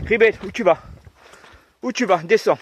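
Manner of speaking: high, loud call to a dog; talking to a dog. Real, not acted. Calling her dog on a walk.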